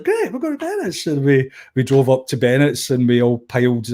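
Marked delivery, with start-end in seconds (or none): 0.0-1.1 high mock-cheerful agreement. Mimicking the singer's reply to a friend, high and chirpy. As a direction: squeak it out like a delighted rock star. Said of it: "And [name] was like".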